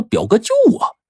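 high-pitched echo of someone's plea; mimicked cry for help. 警察纳闷地学肥仔喊救命. (学着别人高声喊救命)

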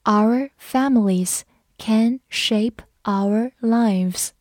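Our families can shape our lives said slowly; slow each word for the class; slow read-along. Shown as a demonstration. Teacher reading slowly so the class can repeat. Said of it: "跟着我慢读一遍".

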